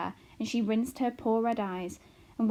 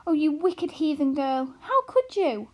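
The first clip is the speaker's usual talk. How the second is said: higher and louder, scandalised; horrified mother; 7 semitones higher, 7 dB louder than usual. A narrator's reading. Mother scolding her daughter, shocked and shrill-high.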